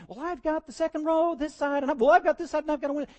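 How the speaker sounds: put-on bossy little kid staking out seats; another kid. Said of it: "and the other one".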